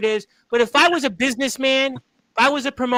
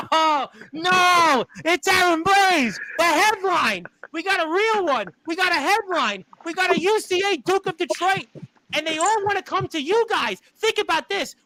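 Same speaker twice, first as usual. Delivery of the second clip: high, fast hype over the news; excited hype announcer; 6 semitones higher than usual. Acted. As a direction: sell the headline with excitement. Host hypes new arrival.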